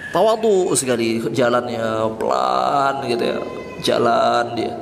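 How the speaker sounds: slow, low mock-humble voice; feigned humility. Preacher mimicking a man's affected slow walk, mock humility. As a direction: drag the words like a put-on feeble walk. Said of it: "dia bikin-bikin jalannya".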